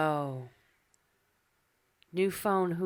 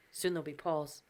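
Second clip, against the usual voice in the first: ordinary talk, nothing marked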